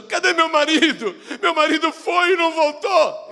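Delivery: high, loud wailing for a husband; wives crying. Acted. Widows wailing for husbands lost at Ai, weeping. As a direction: weep as you call for him. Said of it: "Esposas chorando".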